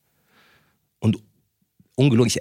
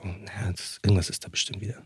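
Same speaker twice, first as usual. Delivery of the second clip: sleepy mumble; 8 dB quieter than usual. Retold or imitated. Sleepy father answering.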